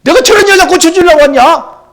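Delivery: high, loud scornful voice of rejection; harsh refusal. Acted. Preacher voices Jesus's harsh refusal. (예수님의 높고 큰 거절하는 목소리)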